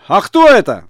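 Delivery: high, loud hail; ringing holler; shouted question. A narrator's reading. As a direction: call out to a far-off stranger. Shouted exchange across a distance.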